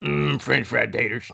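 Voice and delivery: slow, low movie-character impression; grunt, then draw it out low; Sling Blade character. Quoting Sling Blade to a co-host, slow character voice. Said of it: "Sling Blade".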